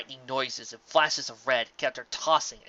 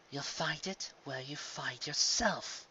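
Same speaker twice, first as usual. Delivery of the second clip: slow soft recall; 7 dB quieter, slower than usual. Acted. Luna recalls sister's riddle.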